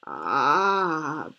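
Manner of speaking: put-on baby bear's loud squeaky cry; drawn-out, put-on squeal; squeak. A narrator's reading. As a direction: let out a startled little cub "Ah!". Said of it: "Baby Bear squeaked".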